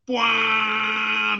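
drawn-out "bwah" blare, held on a high level note; imitated train blare. Telling friends how the train drowned the chat, imitated blare.

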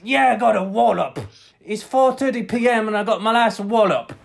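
high, loud boast; loud, high victory crowing; mock triumphant reply. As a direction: shout back in hammed-up celebration. Acting out the celebrating reply.

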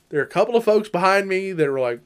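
ordinary talk, nothing marked

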